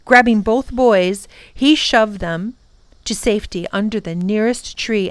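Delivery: ordinary delivery, nothing marked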